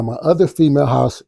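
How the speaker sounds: usual speaking voice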